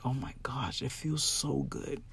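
breathy hushed whisper of wonder; awed whisper. Retold or imitated. To herself after understanding the game, an awed whisper. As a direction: whisper it like a quiet realization. Retold awestruck inner thought.

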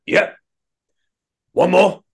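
high, loud grunting shout of a lifter; mock gym grunter shout. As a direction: bark it out like a show-off lifter psyching up. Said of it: "he was just fucking screaming".

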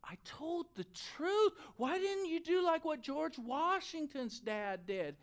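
put-on high, plaintive child protest; mock whimpering. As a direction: whine it like a spanked kid. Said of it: "he's whimpering, and he's crying".

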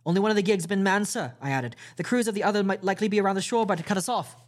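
urgent report. A narrator's reading. Jim warning the captain about the gigs, urgent. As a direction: rattle it off in alarm.

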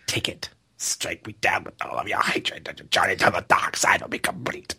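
low hissing evil whisper; tempting devilish whisper. Retold or imitated. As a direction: tempt him in a sinister whisper. Emperor quote whispered.

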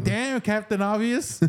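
mock them, half laughing; teasing sarcasm. Teasing friends for stating the obvious, sarcastic. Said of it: "what I used to call you".

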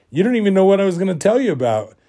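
high, fast retold protest; indignant protest. Dreamer objecting to being prejudged, indignant and rushed. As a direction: blurt it out in mock indignation.

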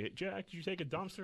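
high-pitched imitation of an old lady; old lady visitor. Old lady visiting patient daily, mock-chirpy.

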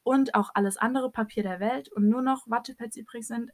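normal talking voice

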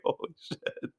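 whispered holy shit; breathy whisper. Retold or imitated. Onlookers staring at the gash, a whispered holy shit. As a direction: hush it like you can't believe it. Everyone reacting in awe.

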